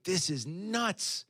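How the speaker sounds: very slow, drawn-out disbelief; amazed. Retold or imitated. Watching the video, slow amazed disbelief. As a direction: savour each word in wonder. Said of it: "I'm like".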